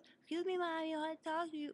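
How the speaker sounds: put-on high, fast, tiny child voice; little voice. Little son asking his mommy to talk, tiny high voice. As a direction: squeak it out like a small boy asking politely. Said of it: "he talked in a little voice".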